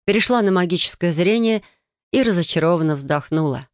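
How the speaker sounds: usual speaking voice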